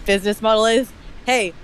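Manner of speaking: ordinary talk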